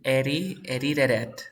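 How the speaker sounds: "Eri, edited it" with D and T tapped; sounds like R. Shown as a demonstration. Teacher modelling the sentence, "edited it" with R-like taps. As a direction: flap each consonant between vowels in "Eri, edited it". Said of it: "suena como R".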